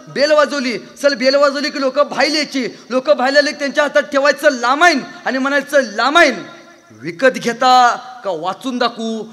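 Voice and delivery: high, loud sales tale with R as L in "लामाईन"; mimicked speech defect, L replacing R in "सर"; lisping boy. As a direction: shout it excitedly, "लामाईन" for Ramayan. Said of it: "ते बोबडं बोलणारं".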